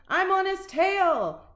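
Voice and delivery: high, loud, slow call; fierce declaration. A narrator's reading. Missus Weatherby chasing the dog. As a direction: shake a fist and shout. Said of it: "declared Missus Weatherby".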